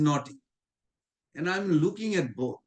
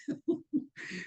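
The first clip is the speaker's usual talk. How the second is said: a short breathy chuckle, softer; light laugh; 9 semitones higher, 8 dB quieter than usual. Real, not acted. Laughing softly after quoting his guru. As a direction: laugh it off quietly.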